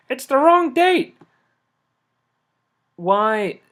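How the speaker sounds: high, loud, slow disbelieving cry; exasperated shriek. Real, not acted. Gamer yelling at the game over the wrong date, high and loud. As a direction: wail it like the game is betraying you. Frustrated repeating the error.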